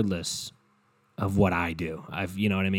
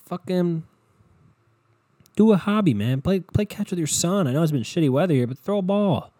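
worked-up rant; 5 semitones higher than usual. Real, not acted. Ranting advice at the listeners.

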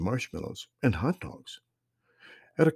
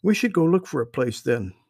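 put-on higher, louder kid voice; little girl voice; 7 semitones higher, 5 dB louder than usual. A narrator's reading. Sister suggests searching for a place, high girl voice. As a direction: voice the eager young sister. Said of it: "Lauren replied".

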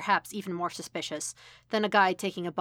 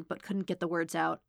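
plain reading voice

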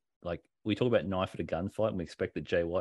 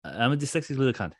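slurred into one breath, a bit high; mock Will Ospreay mumbling it as one word; run together as one word; 4 semitones higher, 3 dB louder than usual. Shown as a demonstration. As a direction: rush it all out as a single word. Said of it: "He said it like that".

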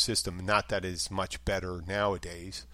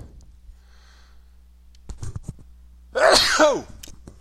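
loud explosive real sneeze; big sneeze; 12 dB louder than usual. Real, not acted. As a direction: let out a huge sneeze. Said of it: "excuse me, I'm gonna sneeze".

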